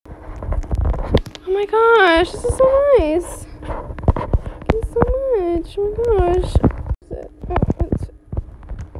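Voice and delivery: high, loud, drawn-out joyful gush; squealing thank-you; overjoyed. Real, not acted. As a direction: melt with gratitude. Overwhelmed by the gift.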